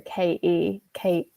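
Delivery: quiet attempt at London T-dropping; London accent. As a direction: imitate schoolmates' London accent, softly. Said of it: "Like, the London accent".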